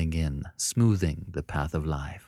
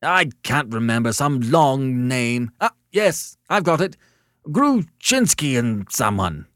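loud, raised voice, drawn out in places; agitated caller; 5 semitones higher, 6 dB louder than usual. A narrator's reading. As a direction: search for it loudly, then seize on it. Agitated caller racks his memory.

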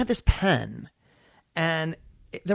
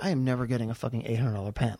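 low, soft, quick grumble; muttered aside; 5 semitones lower, 6 dB quieter, faster than usual. Retold or imitated. Walking away from Tom, muttering quietly. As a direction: mutter it under your breath.

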